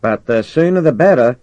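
high, loud nudge; persuasive commander. Acted. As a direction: urge him on from far below. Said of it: "said Norton's persuasive voice".

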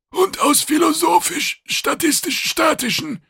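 very high, loud creature voice; officious alien. A narrator's reading. Kidang piling on invented reasons, high and officious. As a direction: add it loudly in a high alien pitch.

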